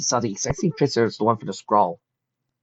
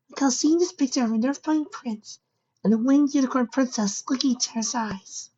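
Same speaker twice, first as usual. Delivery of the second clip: put-on young filly voice. Fireside's voice.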